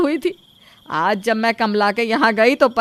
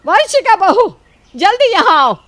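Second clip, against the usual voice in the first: loud, high furious shout across the house; mother-in-law angrily summoning her daughter-in-law to come quickly; angry call; 6 semitones higher, 4 dB louder than usual. Acted. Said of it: "वो गुस्से में वंशिका को आवाज़ लगाती है".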